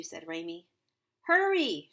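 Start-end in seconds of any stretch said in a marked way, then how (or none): 1.3-1.9 high, loud holler; one big, high-pitched yell; urgent shout. A narrator's reading. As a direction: shout to rush someone along. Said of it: "shouted the voice".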